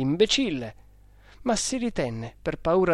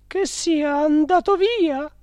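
high, slow woman's question; worried directress; 14 semitones higher, 6 dB louder than usual. A narrator's reading. Directress asking the cook whether the spirit left, high questioning. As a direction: ask it in a high, drawn-out woman's voice. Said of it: "chiese al cuoco".

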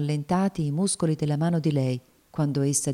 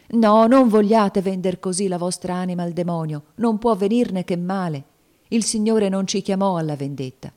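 higher, louder earnest entreaty; imploring; 4 semitones higher, 6 dB louder than usual. A narrator's reading. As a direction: implore her to hold back. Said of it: "implorò Tom gettandosi innanzi a lei".

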